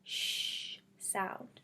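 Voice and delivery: a long hissed sh, then the word sound. Shown as a demonstration. Teacher showing young readers the sh sound. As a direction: hiss out the sh for the kids. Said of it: "S H makes the".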